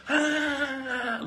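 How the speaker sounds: high laughing imitation of a toddler's wail; mock child wail. Mother on the supermarket floor mimicking her child's wail, laughing. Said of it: "doing the same thing".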